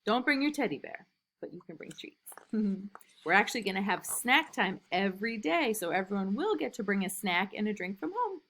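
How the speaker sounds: ordinary delivery, nothing marked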